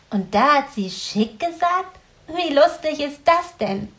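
höhere, lautere Mädchenstimme voller Freude; begeistertes Grünäuglein. A narrator's reading. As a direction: quietsch vor Vergnügen als Grünäuglein. Grünäuglein voiced higher. (Grünäuglein, hell und begeistert)

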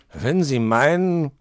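slow, loud grudging growl. A narrator's reading. Herr Fuchs grudgingly agrees with a growl. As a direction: give in through a growl. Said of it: "knurrte der Fuchs".

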